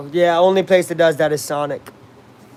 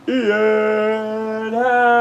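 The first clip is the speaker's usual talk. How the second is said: long, sustained, higher call; drawn-out ballpark announcement; announcer-style name call; 5 semitones higher than usual. Pretend. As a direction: stretch the player's name right out.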